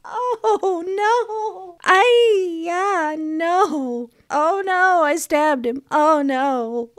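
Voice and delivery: high, loud distraught wailing; tearing up. Real, not acted. Player who just stabbed the character, wailing high and loud in distress. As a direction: cry out in real dismay, near tears. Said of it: "Actually starting to tear up".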